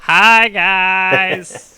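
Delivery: loud, drawn-out greeting; calling out. Acted. Flying creature greets the watching crowd, loud cheery hail.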